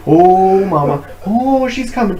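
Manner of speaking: loud high panic about the toilet; panicked urgency. Pretend. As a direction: cry out in urgent dread. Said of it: "you're like".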